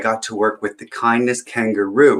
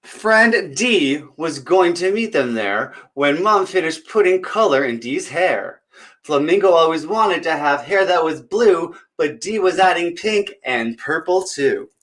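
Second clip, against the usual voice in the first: put-on bright, higher read-aloud voice for kids; storybook reading; 7 semitones higher than usual. Storyteller reading a picture book to children, lively. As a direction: read it like story time at the library.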